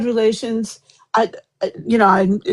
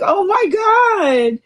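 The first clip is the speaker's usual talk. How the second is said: high, tearful exclaim; overwhelmed oh my God; 8 semitones higher, faster than usual. Retold or imitated. Aunt relives finding her nephew, high and tearful. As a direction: let it crack with joyful shock. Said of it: "I'm like".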